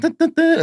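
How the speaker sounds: high vocal dun dun dun circus tune; mock circus music; imitated circus music. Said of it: "circus music in the background".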